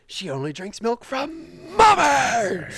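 put-on high, loud kid's protest, drawn out; imitated child's shout. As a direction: shout it slowly like an outraged child. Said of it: "shouting it".

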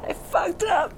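high, soft broken sobbing; crying, nearly spent; quiet sob. Acted. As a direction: whimper it through tears. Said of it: "she's just sobbing".

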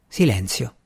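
ordinary delivery, nothing marked

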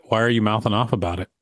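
ordinary delivery

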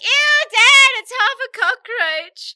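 high, loud grossed-out squeal; disgusted shriek. Acted. Mayor finds cockroach in meal.

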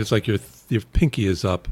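ordinary talk

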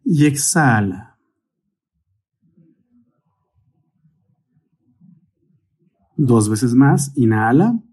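unhurried meditation guide, long pauses between cues; guided meditation. Real, not acted. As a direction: guide the breathing calmly, stretching out each cue word. (meditación guiada, con calma y largas pausas)

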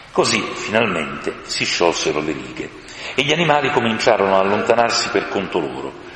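ordinary delivery

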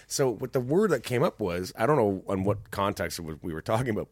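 ordinary talk

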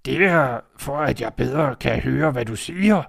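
a predator pretending to be granny; wolf in disguise. Wolf in bed answering Red, slow and sly.

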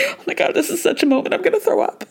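high, fast, breathless joy; overwhelmed gush. Retold or imitated. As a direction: gush like you might burst. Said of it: "covering her mouth with her hands".

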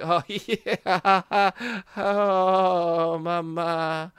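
high, awkward put-on laughter; forced playing along. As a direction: drag it out through an uneasy mock laugh. Playing along nervously with bikies.

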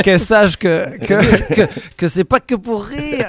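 real amused laughter breaking into the sentence; laughing while talking. Real, not acted. Joking about sending the podcast to his mother, laughing.

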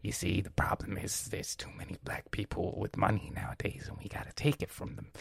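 whispered conspiratorial Nixon; hushed Nixon. Retold or imitated. Nixon whispers his scheme to the audience, hushed. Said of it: "sound like President Nixon".

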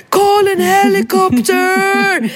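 high frantic yell in English; shrill panicked cry for a helicopter; panicked shout. Retold or imitated. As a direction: scream it for help in a panic. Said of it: "sprang med honom i famnen och skrek".

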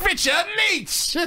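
high, slow mock TV-host voice; hammed-up luxury-show host; Richard Leach. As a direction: draw the name out like a grand presenter. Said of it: "lifestyles of the rich and famous".

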